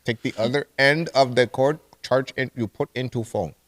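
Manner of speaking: put-on Indian-accented agent giving step-by-step instructions; mock Indian accent. Agent guiding caller to charge phone, mock Indian accent. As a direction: talk down as Indian tech support. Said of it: "I can do the Indian voice".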